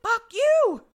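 high, explosive retold yell; hammed-up defiant outburst; mock angry shout. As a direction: hurl it back in mock fury. Retold defiant retort to the group.

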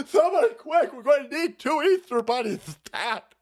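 loud urgent cry for help; panicked call for help. Retold or imitated. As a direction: shout for help like everything is on fire. Voicing panicked staffer.

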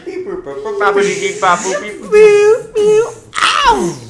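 mock machine beeping, rising high and loud; robot beeps. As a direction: beep like a silly robot. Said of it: "Activating compression".

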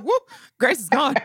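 playful announcement. Retold or imitated. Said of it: "And I'm like".

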